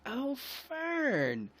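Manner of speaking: high, gushing cry of welcome; delighted greeting. Acted. Matilda greeting Fern at the window, delighted. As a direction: greet an old friend with delight.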